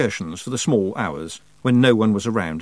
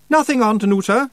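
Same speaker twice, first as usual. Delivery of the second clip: high, raised character voice; nephew calling back; 12 semitones higher, 5 dB louder than usual. Acted. Roland answers his aunt through a locked door, high raised voice.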